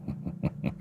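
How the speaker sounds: a soft unvoiced snicker; breathy sly chuckle. A narrator's reading. Man sniggering before asking for money, soft chuckle. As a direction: chuckle slyly under the breath. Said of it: "cười hề hề".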